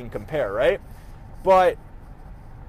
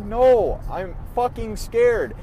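a mild echo of a scared sob, higher and louder; retold tearful fear; 6 semitones higher, 3 dB louder, slower than usual. Telling mom on the phone, a touch tearful and scared. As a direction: let the fear crack through the words. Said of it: "I'm bawling my eyes out to my mom".

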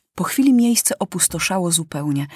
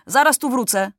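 put-on young lieutenant's brisk announcement, pitch raised; mildly commanding, higher-pitched; giving an order; 6 semitones higher than usual. A narrator's reading. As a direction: tell the troops with authority. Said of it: "rozkazał młody porucznik".

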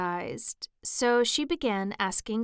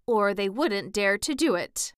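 ordinary delivery

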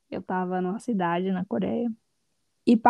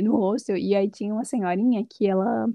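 ordinary talk

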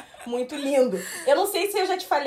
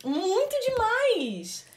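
gushing; 10 semitones higher, 3 dB quieter, much slower than usual. Real, not acted. Overwhelmed gushing.

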